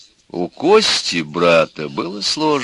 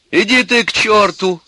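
high, loud angry outburst; tearful shout; 13 semitones higher, 5 dB louder than usual. A narrator's reading. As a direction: yell back at your brother. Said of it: "закричала на него заплаканная Алька". (крик заплаканной сестры)

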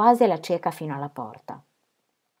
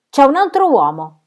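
much louder and higher accusation; jealous outburst; 8 semitones higher, 10 dB louder than usual. A narrator's reading. Rejected Fred accusing Lucy of a rival, loud and stung. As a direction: blurt it out, stung.

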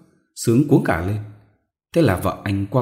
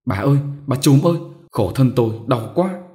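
higher, louder, fast desperate calls; crying out urgently; 5 semitones higher, 4 dB louder than usual. Acted. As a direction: shriek for help in pain. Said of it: "rú lên gọi dồn". (rú lên gọi dồn, cao, to và nhanh)

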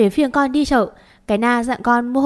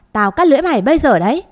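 fast, loud, higher-pitched warning; angry threat; 5 semitones higher, 5 dB louder, much faster than usual. A narrator's reading. Accused mistress threatening her maid, angry. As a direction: snap a threat to silence her.

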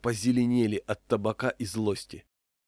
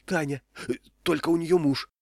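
higher, excited gossiping voice; flustered cleaning lady; 7 semitones higher than usual. A narrator's reading. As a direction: blurt it out, pitched up. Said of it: "радостно испугалась уборщица".

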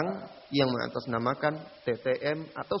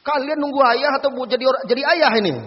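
higher, loud mocking question; sarcastic scolding; 10 semitones higher, 9 dB louder than usual. Ustaz mocking the boy's excuse, loud and sarcastic. Confronting the couple.

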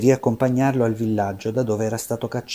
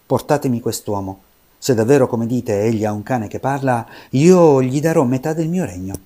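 loud, commanding decree; royal proclamation; 7 dB louder than usual. Acted. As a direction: proclaim it to the whole court. Il re proclama solennemente. (il re proclama a gran voce la sua promessa)

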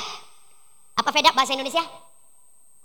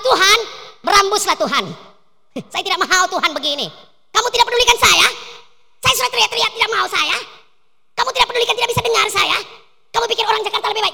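angry outburst; 4 semitones higher than usual. Acted. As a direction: fling the complaint at him. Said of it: "Kalau orang Makassar buat apa?".

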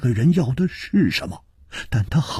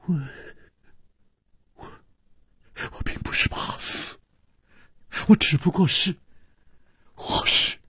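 trembling; 4 semitones higher, 7 dB quieter, faster than usual. A narrator's reading. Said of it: "他颤着说".

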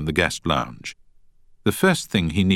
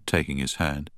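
usual speaking voice

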